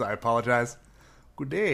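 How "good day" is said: attempted Canadian drifting into Minnesotan; badly faked Canadian accent with a lift; Minnesotan-sounding Canadian. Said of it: "That's more Minnesotan".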